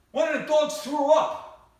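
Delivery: slow, loud, high shout. Retold or imitated. Husband shouting the news to his wife, slow and loud. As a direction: announce it to the whole house.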